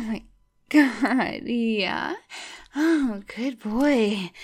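slow, loud drawn-out moans; slow breathless moans. Acted. Lover savouring the afterglow, slow loud drawn-out moans. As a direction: stretch each word out, spent and loud. Coming down from climax.

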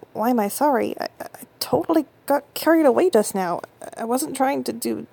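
loud rattled confession; alarmed. Acted. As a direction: blurt it out in dismay. Panicked self-questioning.

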